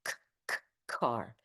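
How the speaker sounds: car with a strong puff of air on the k; plosive English k. Shown as a demonstration. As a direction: spit out the k in car. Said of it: "in English you say car".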